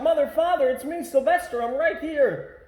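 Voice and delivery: high, loud call to his parents; longing shout. A narrator's reading. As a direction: cry out to mother and father, desperate to be heard. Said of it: "How he wanted to shout".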